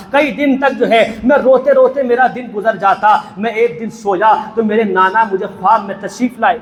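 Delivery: ordinary delivery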